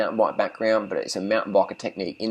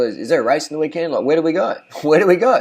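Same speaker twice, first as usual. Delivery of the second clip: imitating puzzled locals, quick and higher, rising questions; mock-clueless, pitched up, half laughing; bewildered locals; 5 semitones higher, 5 dB louder, faster than usual. Quotes bewildered local cyclists asking.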